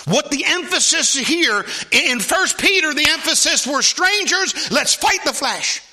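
high, loud, slow proclaiming; full-force preaching. Acted. Preacher pressing the church on strangers and the flesh, at full force. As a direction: hammer each phrase out.

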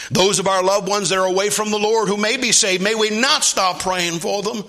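high, loud impassioned plea; preacher's pleading intensity; fervent prayer. Real, not acted. Prayer repeated with fervor.

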